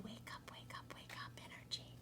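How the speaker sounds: a hushed, secret wake up; whisper. Real, not acted. Soft whisper to the listener, repeating the phrase. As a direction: whisper it softly and far off.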